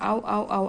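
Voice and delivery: ordinary delivery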